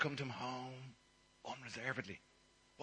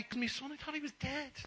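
much higher, overjoyed cry; joyful; 13 semitones higher than usual. Acted. Father celebrating his returned son, overjoyed. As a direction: burst out with joy.

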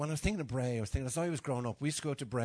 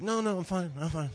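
imitating his mother; 5 semitones higher, 4 dB louder than usual. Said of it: "My ma's terrible".

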